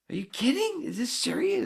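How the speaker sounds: high, loud incredulous yell; outraged disbelief. Retold or imitated. Boy protesting to the umpire, high incredulous yell. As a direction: scream it at the umpire. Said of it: "And I'm like".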